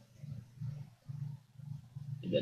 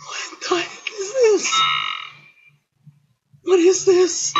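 very high, louder baffled yelp; shrill confusion; 4 dB louder than usual. Real, not acted. Player yelping at the weird minigame. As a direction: yelp out in bewilderment.

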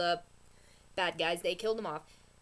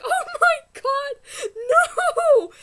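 slow, much higher and louder shriek; horrified cry; 12 dB louder, much slower than usual. Retold or imitated. Reliving her reaction to the episode, shrieking cry. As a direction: cry out in disbelief. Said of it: "And I was like".

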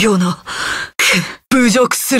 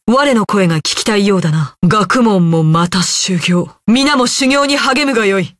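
normal talking voice

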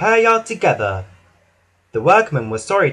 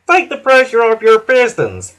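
higher, louder friendly reassurance; kindly; 8 semitones higher, 7 dB louder than usual. A narrator's reading. Hank calming Thomas, kind and bright. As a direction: ease a struggling friend. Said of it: "Hank whistled kindly".

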